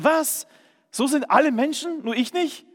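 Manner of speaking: high, indignant astonishment; envious disbelief. Retold or imitated. Preacher recalling envy of others' energy, high incredulous outburst. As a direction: cry out in jealous disbelief. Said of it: "Ich war neidisch, dachte".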